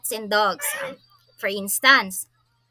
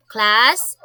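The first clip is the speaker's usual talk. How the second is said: calling out to class; 7 dB louder than usual. Acted. Teacher hushing a noisy class.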